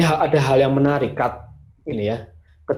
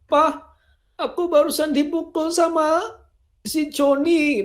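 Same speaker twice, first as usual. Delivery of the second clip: put-on higher childlike complaining voice; child tattling. Child complaining to his father about Joni, higher childish voice. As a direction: tell on Joni to Dad, up high. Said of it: "kayak seorang anak dia ngadu sama bapaknya".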